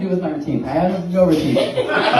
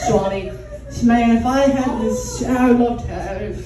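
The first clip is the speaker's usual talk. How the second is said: higher-pitched, halting woman's character voice; doting female fan character; 4 semitones higher, 3 dB louder, slower than usual. Acted. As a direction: gush haltingly in a put-on accent. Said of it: "so here's my character".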